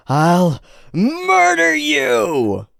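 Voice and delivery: slow, high raging threat; berserk rage. Acted. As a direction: drag out the murderous threat.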